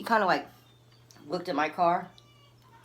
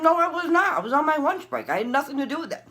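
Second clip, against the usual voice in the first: high, loud, outraged protest; acting out a heated self-defence; indignant denial; 12 semitones higher, 6 dB louder than usual. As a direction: deny everything at the top of your pitch. Acted heated denial to officer.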